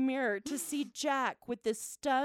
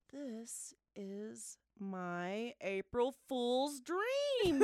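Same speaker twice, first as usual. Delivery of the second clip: savouring realization; much slower than usual. Retold or imitated. Mom spots the jail for her prank, slow dreamy relish. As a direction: stretch it out slowly, as if dreamily delighted. Drawn-out delight at seeing the jail.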